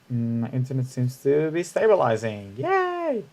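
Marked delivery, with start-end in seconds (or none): 2.6-3.3 high squeaky yay of relief; a little celebratory squeak; happy cheer. Real, not acted. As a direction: squeal it out with joy. Internet finally stabilizing, cheering.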